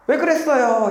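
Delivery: high, whiny complaint; 투덜거리며 불평하듯; complaining. Retold or imitated. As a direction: sound like you're grumbling at God. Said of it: "이러면서 불평하는 것 같아요".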